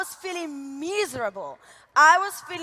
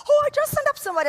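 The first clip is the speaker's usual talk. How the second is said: imitating excited caller; 10 semitones higher, much faster than usual.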